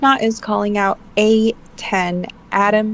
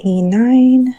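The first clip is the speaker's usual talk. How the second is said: loud, drawn-out bingo call; bingo caller; 3 semitones higher, 6 dB louder than usual. Shown as a demonstration. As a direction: call it like a bingo hall announcer. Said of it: "I feel like the bingo caller".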